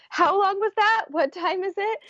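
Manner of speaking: dazed and overwhelmed, half laughing; laughing half crying. Retold or imitated. Right after the birth, laughing through tears. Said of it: "I remember saying things like".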